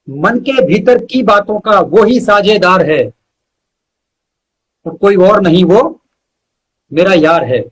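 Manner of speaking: measured, rhythmic recital with pauses between phrases; unhurried poetry reading, each line landing on its end; poem recitation. Acted. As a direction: recite it like a heartfelt ode to your best friend. Recites his friendship poem.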